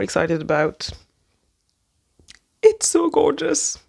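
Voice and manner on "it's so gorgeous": high, squealed "It's so gorgeous"; gushing. Real, not acted. To viewers, showing the vintage book, swooning. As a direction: swoon over the cover.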